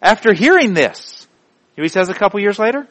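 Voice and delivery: ordinary talk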